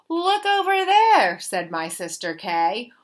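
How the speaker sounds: ordinary delivery, nothing marked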